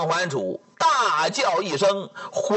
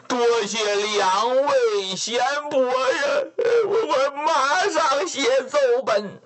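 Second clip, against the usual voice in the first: sobbing thanks; 5 semitones higher than usual. Acted. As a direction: thank them through sobs, stammering. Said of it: "语泪千行".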